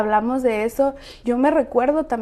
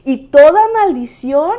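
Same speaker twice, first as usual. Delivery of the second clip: oración; 7 dB louder than usual. Retold or imitated. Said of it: "entonces dices".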